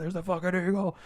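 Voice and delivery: high-pitched fan thrill, held down in level; excited reaction. Retold or imitated. Fan retelling his reaction as the eagle gets drawn, excited.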